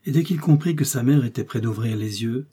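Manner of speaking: usual speaking voice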